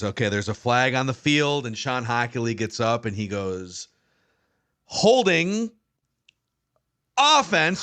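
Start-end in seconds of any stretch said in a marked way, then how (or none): 4.9-7.8 high mock ref call with a long pause between words; dramatic pause. Imitating the ref's dramatic mic call, with a long pause. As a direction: hold the pause before offense like a showman ref. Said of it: "he makes you pause".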